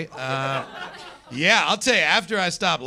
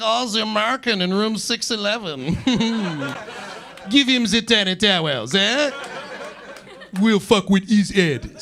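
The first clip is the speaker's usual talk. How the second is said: mock sneering concierge; 5 semitones higher, 3 dB louder than usual. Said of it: "the concierge is down there like".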